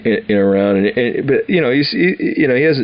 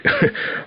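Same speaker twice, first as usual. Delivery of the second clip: quick amused laugh; a brief real laugh at the memory; short laugh; 4 dB quieter than usual. Real, not acted. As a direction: laugh it off lightly. Laughing at the soldiers' looks.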